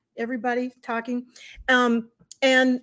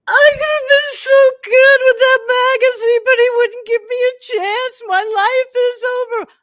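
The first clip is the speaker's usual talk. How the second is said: a hammed-up sobbing rant, much higher and louder; sobbing breakdown; 13 semitones higher, 11 dB louder than usual. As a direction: replay the breakdown as a high, loud wail. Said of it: "I start screaming and crying".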